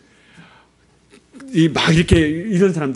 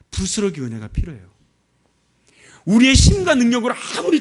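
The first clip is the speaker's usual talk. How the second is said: raised, urgent preacher's voice, pressing the point home; fervent preaching; 8 semitones higher than usual. Acted. Missionary urging the congregation in a sermon, raised fervent preaching. Preaching builds to high pitch.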